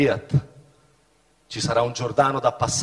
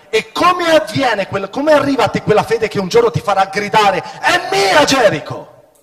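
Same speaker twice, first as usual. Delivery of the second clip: preaching at full force; 9 semitones higher, 9 dB louder than usual. Acted. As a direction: lift the church toward the shout. Preacher rising to a cry. (predica a piena voce, in crescendo)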